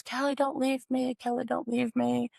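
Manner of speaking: high, plaintive retold plea; pleading mother. Mother in pain begging her daughter to stay, pleading. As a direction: imitate a mother begging, without screaming.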